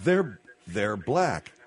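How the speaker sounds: very slow, low, drawn-out words; exaggeratedly slow. Shown as a demonstration. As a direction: spell it out at a crawl. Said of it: "I said say it slowly".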